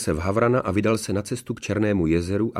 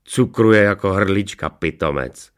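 slow, louder mocking dog voice; sneering hound; 6 dB louder, slower than usual. A narrator's reading. Hound mocks his cooing master, sneering. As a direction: sneer at the lovesick fool. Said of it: "ušklíbil se Fekota".

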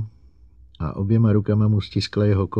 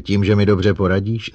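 raised, louder scornful question; contemptuous; 4 semitones higher, 7 dB louder than usual. A narrator's reading. Psychologist mocking the robot's offer of help, contemptuous. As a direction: scoff at the offer with a lift in pitch. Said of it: "zeptala se ho opovržlivě".

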